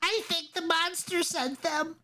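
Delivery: put-on very high child's voice, suspicious; whisper-worried like a small boy; frightened little boy. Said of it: "looks at the girl and says".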